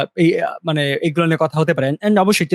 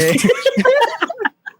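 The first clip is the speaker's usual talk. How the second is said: a long, high, real laugh; high laughter. Real, not acted. Laughing after teasing friends about podcast episodes.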